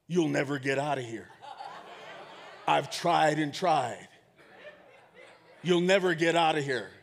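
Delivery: low, deadpan voice in the grave; low storyteller's voice for a mysterious figure; voice from behind. Acted. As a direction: play the unseen stranger, pitched low. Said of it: "he hears a voice behind him".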